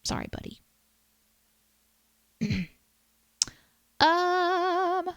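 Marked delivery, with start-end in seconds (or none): 0.0-0.6 a soft, guilty whisper; whispered sorry. Real, not acted. Whispering sorry to the friend she forgot to follow. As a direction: breathe the apology, barely voiced.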